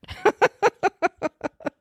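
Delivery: high peal of quick laughs; laughing. Real, not acted. After blessing a rude person's heart, high amused laughter. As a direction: burst out in a high giggle.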